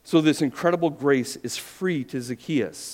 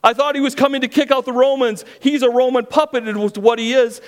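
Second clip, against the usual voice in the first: much higher, loud outraged complaint; indignant grumbling crowd; 10 semitones higher, 7 dB louder than usual. Acted. Preacher acts the grumbling crowd, outraged, much higher and loud. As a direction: rail against Jesus as a betrayed bystander. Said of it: "began to grumble".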